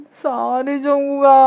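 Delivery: high, loud, drawn-out wailing sorry; acted whiny sobbing plea; tearful apology. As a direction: stretch the apology out like a cry. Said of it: "அழுவுறீங்க".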